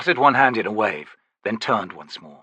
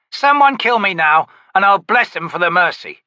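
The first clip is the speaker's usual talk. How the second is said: gruff, louder, higher grumble; growling soldier; 9 semitones higher, 7 dB louder than usual. Acted. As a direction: complain like a sore grunt. Ansi grumbles.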